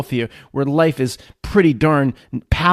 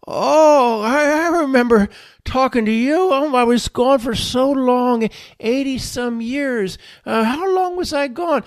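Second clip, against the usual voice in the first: put-on higher-pitched old man voice; groggy old man; 6 semitones higher than usual. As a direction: wake up dazed, like an old man. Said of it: "stop talking like you're an old man".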